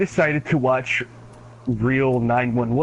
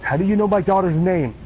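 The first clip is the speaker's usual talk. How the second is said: a father suddenly frightened; mock alarmed; 6 semitones higher than usual. Dispatcher realising it knows his daughter, higher alarmed. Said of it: "And the guy's like".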